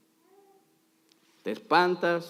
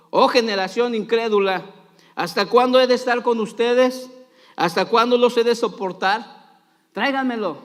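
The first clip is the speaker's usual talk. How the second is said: louder, higher dramatic reproach; stern rebuke; 5 semitones higher, 6 dB louder than usual. A narrator's reading. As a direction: declaim it as exasperated scripture. Acts Jesus rebuking the crowd.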